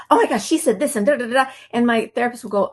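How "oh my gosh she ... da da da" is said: fast, higher, excited babble; mock-frantic rush, higher and quicker; gushing. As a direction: rattle it off all worked up. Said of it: "I'll say".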